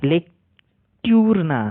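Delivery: লেকটিউর dragged out very slowly, t and u kept apart; wrong lecture reading. Shown as a demonstration. Showing students the wrong লেকটিউর, slow and drawn out. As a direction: stretch লেকটিউর way out. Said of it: "লেকচার".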